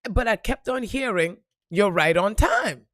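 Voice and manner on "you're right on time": loud, slow imitation of an inner voice; insistent message. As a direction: push the message out slowly and loudly. Insistent message she kept hearing.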